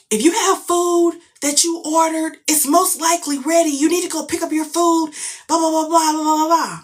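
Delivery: high, loud imitated PA announcement; announcer. Staff telling bowlers to pick up food, mock announcer. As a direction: call it out evenly like a loudspeaker notice. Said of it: "somebody comes on announcements and said".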